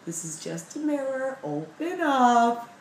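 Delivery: put-on talking to a child. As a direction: coax her like a scared toddler. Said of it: "talking to me like a child".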